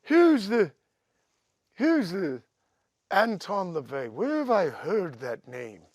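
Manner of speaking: lightly hammed-up puzzled Geezer; imitating Geezer. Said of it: "Geezy goes".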